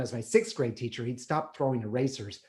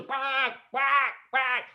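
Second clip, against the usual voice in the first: mock quacking; 10 semitones higher, much slower than usual. Imitating chickens at dawn.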